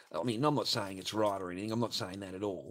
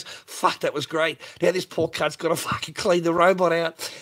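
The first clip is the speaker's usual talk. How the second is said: louder, hammed-up voice of a pleased customer; mock satisfied customer; 5 semitones higher, 8 dB louder than usual. Customer leaving after his session, loud hammed-up satisfaction. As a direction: play the smug customer walking out, loudly. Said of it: "walks out and goes".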